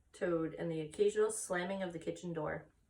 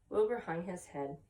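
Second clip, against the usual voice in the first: ordinary delivery, nothing marked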